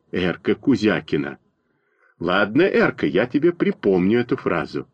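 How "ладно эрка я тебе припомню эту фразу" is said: ordinary delivery, nothing marked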